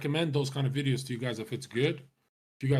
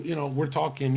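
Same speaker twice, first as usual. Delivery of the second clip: usual speaking voice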